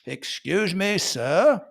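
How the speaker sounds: upper-class British "sir" with no R; fancy British, leave off the R in "sir"; posh British accent. Acted. Posh Brit addressing a gentleman, British accent. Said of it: "posh British people".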